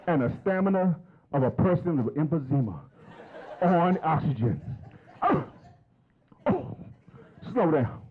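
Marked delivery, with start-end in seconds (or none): 5.2-8.0 out of breath. Real, not acted. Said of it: "I gotta catch my breath".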